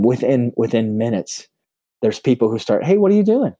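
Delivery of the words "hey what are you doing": higher, louder passing call-out; curious passer-by. Retold or imitated. Passer-by to the man at the desk, curious call-out. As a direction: toss out a high-pitched passing question.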